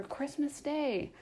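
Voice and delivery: soft, slow gentle reminder; calm him down gently; mild and soft. A narrator's reading. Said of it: "was Bob's mild answer".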